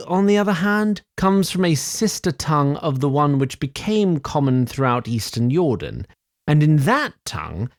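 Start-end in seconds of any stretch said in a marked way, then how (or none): none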